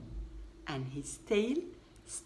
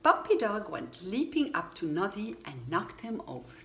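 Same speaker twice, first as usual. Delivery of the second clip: usual speaking voice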